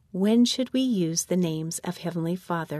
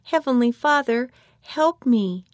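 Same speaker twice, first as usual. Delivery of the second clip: raised, higher cry for help; 5 semitones higher, 6 dB louder than usual. A narrator's reading. Child praying for rescue from a lunging dog, crying out. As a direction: call out a prayer, louder and higher. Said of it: "I cried out as loud as I could".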